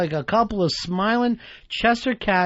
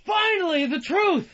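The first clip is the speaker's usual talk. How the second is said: high-pitched theatrical cry; hammed-up film line, pitched way up; movie scene impression; 12 semitones higher than usual. Co-host acting out a little Almost Famous scene, high theatrical outburst. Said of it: "I just did a little scene from Almost Famous".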